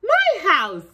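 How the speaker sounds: put-on high, loud toddler echo; baby voice. A narrator's reading. Baby brother echoing mama in high voice. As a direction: chirp it like a proud baby. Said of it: "he replied".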